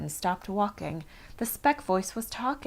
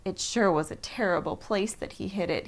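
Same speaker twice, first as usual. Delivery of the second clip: ordinary delivery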